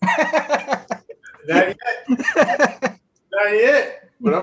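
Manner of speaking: high, loud words through laughter; laughing, overheated. Real, not acted. Trader answering whether he is okay, helpless high laughter. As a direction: squeeze it out laughing, like hot pepper hit. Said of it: "You sound like you ate the, the habanero".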